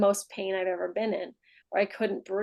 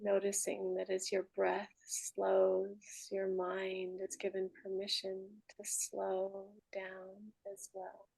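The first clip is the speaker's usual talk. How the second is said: guided meditation; 8 dB quieter than usual. Real, not acted. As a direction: ease them down gently, with long pauses between phrases. Leads a final meditation.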